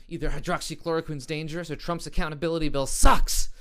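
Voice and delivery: loud emphatic punchline. Real, not acted. Comedian delivering the joke's conclusion, loudly. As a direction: land the conclusion hard.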